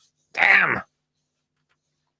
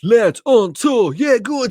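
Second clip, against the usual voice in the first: rowdy jeering pub banter; 9 semitones higher, slower than usual. Pretend. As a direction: egg him on like a pub crowd. Chanting at friends on screen.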